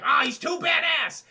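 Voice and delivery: mock shout. Streamer mocks characters amazed at the badass hero, hammed-up shout. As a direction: belt it out slow like an amazed crowd.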